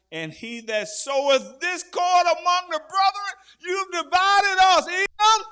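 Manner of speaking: high, loud, slow accusing cry; preaching shout. Real, not acted. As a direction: thunder the verse at the accused. Shouting scripture at Edom.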